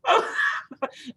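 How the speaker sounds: high, tearful burst of laughter; high crying laugh. Real, not acted. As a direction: laugh like it almost hurts. Laughing after panic quote.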